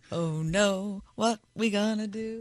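hushed mock panic of a cartoon vegetable; cartoon veggie voice. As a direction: play a fretful VeggieTales character under your breath. Quoting a VeggieTales character.

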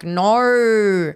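drawn-out Australian "No."; broad Australian "No" imitated; mock Aussie no. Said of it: "funny TikToks about how people are like".

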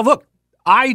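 normal talking voice

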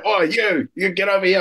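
high-pitched mock bark at a new recruit; instructor calling out. Staff ordering new recruit at the train station, mock shout. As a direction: imitate an instructor hollering orders without full volume. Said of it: "and they go".

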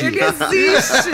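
laughing. Real, not acted. Joking about the guest's voice.

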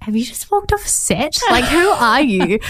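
lightly hammed-up swooning; starstruck. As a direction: gush at the handsome doctor. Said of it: "I was like".

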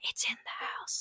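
very soft, eerie whisper; hushed horror-film warning; horror-movie whisper. Retold or imitated. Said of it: "like in a horror movie".

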